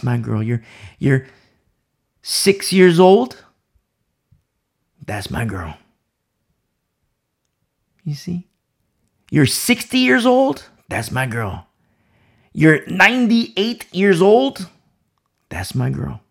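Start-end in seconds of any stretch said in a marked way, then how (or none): 2.2-3.4 higher, louder, slow and drawn out; emphatic call. Real, not acted. Preacher calling out a listener's age, loud and slow. 9.3-10.6 emphatic call. Real, not acted. Calling out ages emphatically. 12.5-14.7 higher, louder, slow and drawn out; emphatic call. Real, not acted. Preacher calling out a listener's age, loud and slow.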